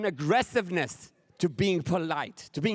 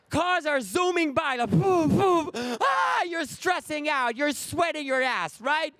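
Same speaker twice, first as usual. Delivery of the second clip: mock stress; 8 semitones higher than usual. Stressful left turn.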